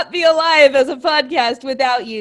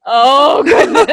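delighted exclaim; 9 dB louder than usual. Real, not acted. Delighted reaction, loud, high.

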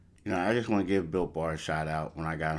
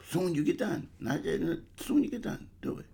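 playful urging; 12 semitones higher than usual. Acted. Insisting, playful high urging.

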